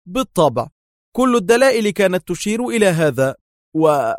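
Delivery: high, loud offhand reply; dismissive. A narrator's reading. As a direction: toss it off smugly. Dar shrugs, answering.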